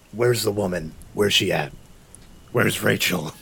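lightly growled Batman demand; a hint of gruff Batman. Player interrogating the goblin, mild Batman growl. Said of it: "Is he just Batman".

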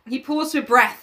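ordinary delivery

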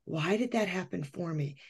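nearly under her breath, trailing off. Retold or imitated. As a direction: let it sink away, very soft. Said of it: "And I thought".

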